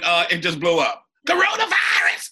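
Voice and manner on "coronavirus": put-on shrieked high with a loud accented yell. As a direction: belt the catchphrase way up high.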